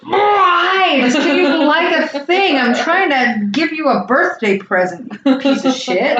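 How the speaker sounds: acted exasperated outburst, high and drawn out; exasperated parent. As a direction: wail it like a fed-up parent, mock-angry.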